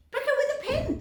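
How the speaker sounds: high, loud urgent call; another father. A narrator's reading. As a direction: call out the idea. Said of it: "said one of the other fathers".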